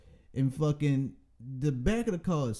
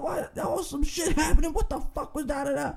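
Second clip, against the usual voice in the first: higher, mock-furious yell; 9 semitones higher than usual. Driver whose new car was hit, mock yelling at him. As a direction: rage at the driver, retold. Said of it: "He said".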